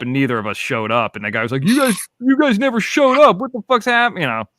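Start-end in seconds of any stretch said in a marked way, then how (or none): 1.7-4.2 high-pitched mock whine of protest; indignant complaint. Friend scolding no-shows, mock indignant. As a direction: imitate a stood-up friend, voice pitched way up.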